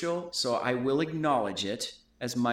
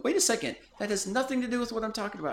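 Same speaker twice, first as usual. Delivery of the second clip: high, quick sudden realization; startled self-quote; 9 semitones higher, much faster than usual. Retold or imitated. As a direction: snap out of a tangent, fast and high. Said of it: "and I'd go".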